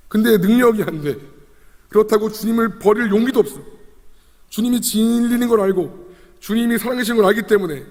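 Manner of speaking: high, impassioned lament; anguished pleading. Acted. Peter can neither follow nor forsake the Lord, anguished. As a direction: pour it out, torn and helpless. Voicing helpless love, torn believer.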